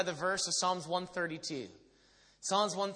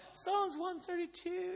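high, slow, softened tearful voice; mock crying; 12 semitones higher, 5 dB quieter, slower than usual. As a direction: imitate preaching through tears. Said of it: "I'm just crying".

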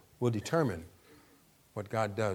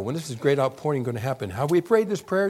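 ordinary delivery

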